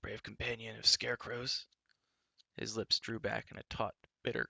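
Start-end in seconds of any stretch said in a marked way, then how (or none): 0.0-1.6 a touch of hoarseness, mocking; hoarse voice. A narrator's reading. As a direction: scrape the words out with contempt. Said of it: "he said in a hoarse voice".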